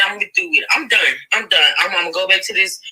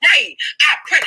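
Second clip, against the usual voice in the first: emphatic; 7 semitones higher than usual. Real, not acted. Heated prayer line in argument.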